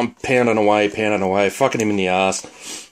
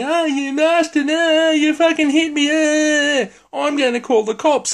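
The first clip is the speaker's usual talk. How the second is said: very high, drawn-out whiny troll voice; high-pitched hammed-up whinge; whinging troll; slower than usual. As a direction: wail and complain in a squeaky mock voice. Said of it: "He starts whinging and complaining".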